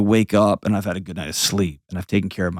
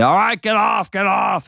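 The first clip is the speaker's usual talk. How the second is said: playful shout; 11 semitones higher than usual. Acted. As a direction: shoo the kids off, half laughing, half yelling. Mom playfully shaking off kids.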